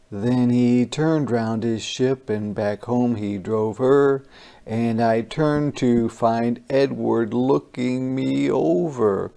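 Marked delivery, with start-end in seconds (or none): none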